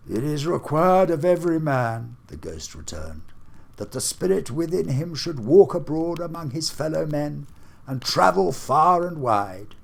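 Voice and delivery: a booming ghost demanding an answer. Acted. Marley's ghost challenges Scrooge, solemn and booming. Ghost speaks in read story.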